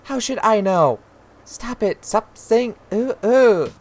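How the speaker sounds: high, loud exasperated yelling; mother at the end of her patience; furious mother. Acted. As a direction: yell at a whining child, mimicking the whine loudly. Mother snaps before slapping child.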